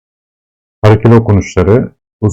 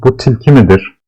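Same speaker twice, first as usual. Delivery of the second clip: ordinary talk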